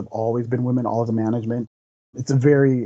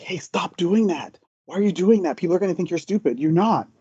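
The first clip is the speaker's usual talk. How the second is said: higher-pitched, mildly exasperated telling-off; retold scolding; 6 semitones higher than usual. Speaker retelling how he told his brother to stop, mildly scolding. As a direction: urge him to stop, pitched up.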